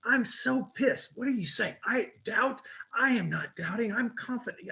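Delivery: high-pitched, indignant protest; acting out wounded indignation; retold anger. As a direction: protest as if insulted, not fully shouting. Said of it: "I was so angry".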